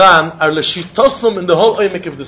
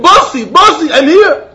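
higher, louder calling out; retold yell; 7 semitones higher, 5 dB louder than usual. Man at the river yelling that he came, raised call. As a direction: shout that he has arrived. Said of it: "He was yelling".

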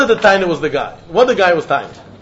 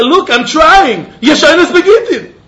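higher, slow pleading; pleading man; 7 semitones higher, 4 dB louder than usual. Retold or imitated. Man pleading with the rabbi that he is trying, mock plea. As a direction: beg to be believed. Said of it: "Rabbi said".

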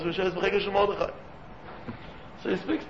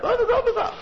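laughing; 5 dB louder than usual. Real, not acted. Laughing exclamation about protesters.

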